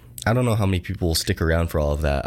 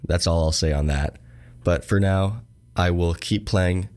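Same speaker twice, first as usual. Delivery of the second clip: ordinary talk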